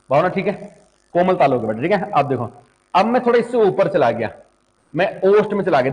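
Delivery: ordinary talk, nothing marked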